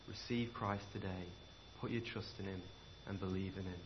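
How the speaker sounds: soft, low pleading invitation; preacher softly inviting the congregation to believe; tender appeal. Acted. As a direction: gently urge them to receive Christ. Quiet altar call.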